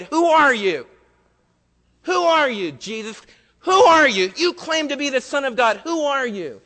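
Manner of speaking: high, loud, accusing demand; furious retold challenge; angry crowd shout. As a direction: shout it at him like a hostile mob.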